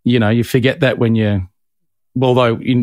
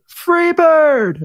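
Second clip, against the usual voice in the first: high, drawn-out crowd holler; mock heckler's yell. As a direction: imitate a punter yelling from the crowd. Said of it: "someone will go".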